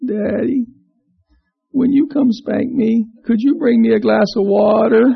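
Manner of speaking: totally innocent punchline; little boy sweetly asking for water. Acted. Boy's punchline.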